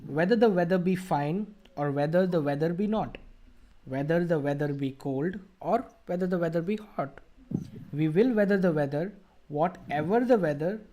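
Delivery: deliberate model reading of the tongue twister; careful articulated reading. Shown as a demonstration. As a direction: clear recitation with pauses at each comma. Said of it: "I'll try to read it with proper pronunciation".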